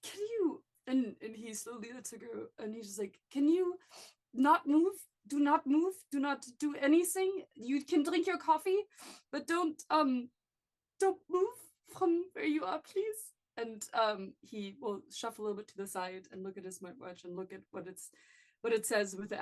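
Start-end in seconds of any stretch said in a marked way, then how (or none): 3.3-13.3 higher, louder, insistent; urgent pleading. Acted. Felix urging his sister not to move, higher and insistent. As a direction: beg her to stay put.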